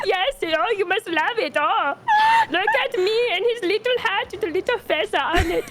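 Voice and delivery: high, whiny gushing mock; hammed-up sobbing delight.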